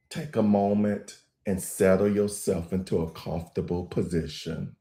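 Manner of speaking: low, calm meditation voice with long pauses; even, grounded delivery, leaving room to breathe; guided meditation. Acted.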